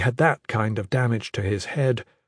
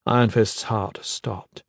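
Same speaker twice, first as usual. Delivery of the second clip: ordinary delivery, nothing marked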